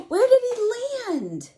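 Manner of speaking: high, amazed question after a gasp; wide-eyed storybook surprise for a child; surprised gasp. Acted. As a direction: gasp and ask in wonder. Gasping at the picture.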